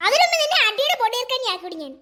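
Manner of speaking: put-on a stuck-up doll brushing the girl off; haughty doll. Doll haughtily refusing to play with the girl.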